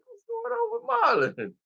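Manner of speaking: mock high squeak of confusion; high puzzled Michael. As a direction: pitch it up, baffled. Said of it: "looking at him like".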